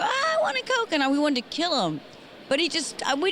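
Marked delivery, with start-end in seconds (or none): 0.0-0.9 very high, whining demand; whiny brother voice. Retold or imitated. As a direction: squeal it like a spoiled invalid brother. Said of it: "he'd sit there and go".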